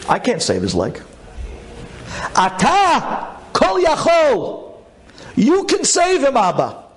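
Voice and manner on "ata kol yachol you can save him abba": much higher, straining, near tears; desperate pleading prayer. Retold or imitated. Son praying to God for his father's leg, pleading tearfully. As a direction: cry out to God like a child begging his father.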